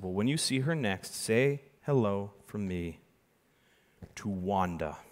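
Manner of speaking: drawn-out hushed reveal; slow gentle magician reveal; slow quiet reveal. Retold or imitated. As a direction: let the name land softly. Retold second reveal.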